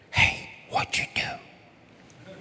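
slow, breathless gossipy whisper; nosy whisper. Retold or imitated. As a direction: lean in and whisper it like a nosy neighbor. Nosy neighbor whispering in church.

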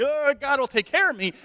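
high-pitched naive sing-out; mock carefree. Careless believer going through life, mock carefree. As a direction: parody blithe, thoughtless faith.

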